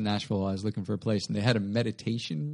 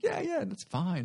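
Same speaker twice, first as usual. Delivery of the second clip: put-on high, chirpy old couple; lightly voiced kindly hosts; sweet old lady voice; 10 semitones higher, 4 dB quieter, slower than usual.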